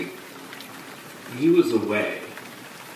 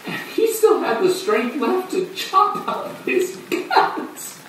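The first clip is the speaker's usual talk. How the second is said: high-pitched, mounting horror; horrified, frantic telling; 6 semitones higher than usual. Acted. Horrified account of a violent self-mutilation.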